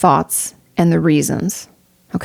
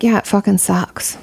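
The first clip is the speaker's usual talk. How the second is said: resigned acknowledgment. Retold or imitated. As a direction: let it sink in and admit it sucks. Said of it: "And then I'm like".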